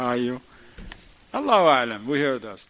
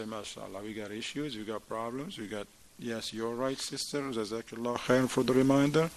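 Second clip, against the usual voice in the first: humble admission; 4 semitones lower than usual. Acted. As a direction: gently thank her for the reminder. Humble husband admits his faults.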